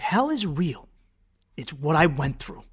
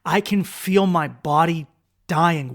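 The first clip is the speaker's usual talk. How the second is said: ordinary talk, nothing marked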